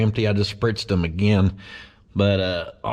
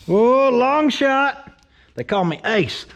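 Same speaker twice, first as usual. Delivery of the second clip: cocky showoff; 11 semitones higher, 3 dB louder, slower than usual. Pretend. Bragging before a spray shot.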